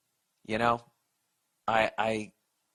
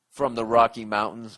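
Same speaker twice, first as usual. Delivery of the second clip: ordinary delivery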